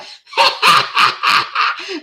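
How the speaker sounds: high breathless helpless laugh; burst of laughter. Retold or imitated. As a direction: crack up uncontrollably. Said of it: "And I'm like".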